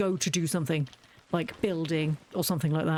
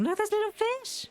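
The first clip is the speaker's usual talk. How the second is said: much higher, cutesy squeak; squeaky little voice; 13 semitones higher than usual. Real, not acted. Gamer delights at little fish, high squeak. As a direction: squeal it like something tiny and cute.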